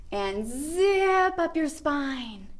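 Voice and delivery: slow zipping up. Shown as a demonstration. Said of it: "take our imaginary zipper".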